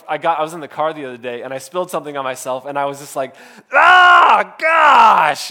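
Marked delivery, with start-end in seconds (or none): 3.7-5.5 sharp, loud, high yelp of surprise; sudden yell. Retold or imitated. Dad yelping in the car after spilling coffee, loud startled yell. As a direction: blurt it out loud as if hot coffee just spilled. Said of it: "He just heard me yell".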